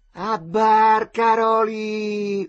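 long, loud, high call. Acted. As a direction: hail the boat from the pillar. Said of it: "uno gridava". (richiamo gridato)

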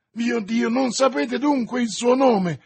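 high, loud, exasperated cry; frantic yelling. A narrator's reading. D'Artagnan pressing her for the name, frantic yelling. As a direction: cry it out in desperate urgency. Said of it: "urlò D'Artagnan".

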